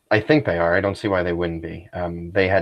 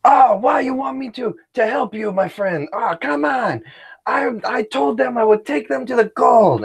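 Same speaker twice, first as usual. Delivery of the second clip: much higher, crying shout. Acted. Tuco shouting to wake the camp, a high crying shout. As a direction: wail it out loud as a desperate bandit. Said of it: "And he starts shouting, like, really loud".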